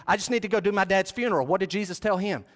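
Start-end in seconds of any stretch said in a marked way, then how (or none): none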